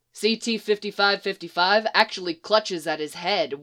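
ordinary delivery

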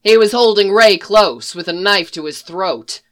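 usual speaking voice

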